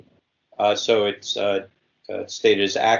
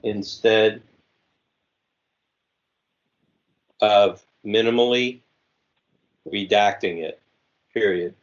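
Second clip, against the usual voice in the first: dictating. Shown as a demonstration. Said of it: "Okay, I am ready again".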